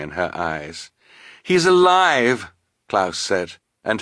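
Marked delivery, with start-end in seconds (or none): 1.4-2.5 high, slow, emphatic assurance; urgent consoling exclamation; earnest reassurance. A narrator's reading. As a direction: insist on it, high and drawn out. Klaus consoles sister after friend's loss.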